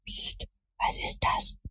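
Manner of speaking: slow, fully whispered alarm; hushing whisper. A narrator's reading. Tom pricks up his ears at a sound, hushing whisper. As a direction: whisper it slowly, barely voiced. Boy hushes friend at a noise.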